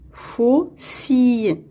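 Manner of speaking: "Faucille" slowly split fau, cille; syllable by syllable. Shown as a demonstration. Teacher locating a sound for pupils, "Faucille" slowly in two parts. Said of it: "dans la deuxième syllabe".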